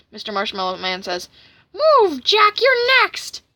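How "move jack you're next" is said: very high, loud, drawn-out threat; hammed-up menacing shout; threatening cartoon villain. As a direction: order him aside in a high, loud voice.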